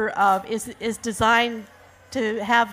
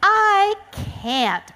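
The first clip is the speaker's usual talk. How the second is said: high-pitched crane croak; acted crane's refusal; 10 semitones higher, 4 dB louder than usual. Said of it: "croaked the crane".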